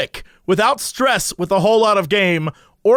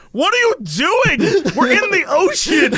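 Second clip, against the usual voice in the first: higher, exaggerated disbelief; mock incredulous outburst; 7 semitones higher than usual. Man confronted on his boat, mock incredulous. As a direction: protest in comic bewilderment.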